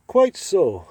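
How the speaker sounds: hammed-up posh British reply; mock British gentleman. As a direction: toss it off like a haughty British gent. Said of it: "Two British guys meeting on the street".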